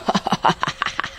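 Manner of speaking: witch's whispered laugh. Acted.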